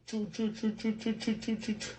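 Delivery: high, rapid "choo-choo" noises; imitated train chugging. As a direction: chug like a little toy train.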